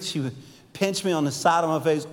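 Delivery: ordinary delivery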